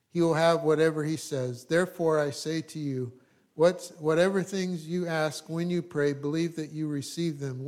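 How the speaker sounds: loud, ringing Bible reading; preacher reading scripture with force. Acted. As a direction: proclaim the verses to the congregation. Reading scripture aloud, louder.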